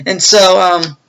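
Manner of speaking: ordinary delivery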